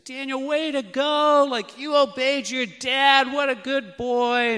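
put-on father praising his toddler who finally obeyed; lavish praise. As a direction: heap delight on the little boy. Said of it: "I'm lavishing praise him".

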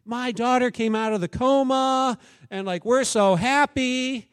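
happy mother. Retold or imitated. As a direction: share the good news beaming. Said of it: "She goes".

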